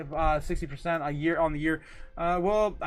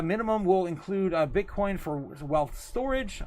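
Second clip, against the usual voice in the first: ordinary talk, nothing marked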